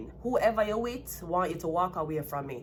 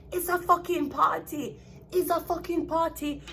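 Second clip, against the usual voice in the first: high mock voice repeating her line; hammed-up high mimic of a careless girl; mocking her excuse; 9 semitones higher than usual.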